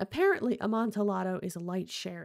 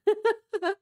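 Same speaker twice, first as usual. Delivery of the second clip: high, loud unhinged laugh; eerie laugh; 13 semitones higher, 8 dB louder than usual. Acted. Walled-in Fortunato laughing madly at his captor. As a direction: let out a crazed laugh from the dark. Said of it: "a low laugh".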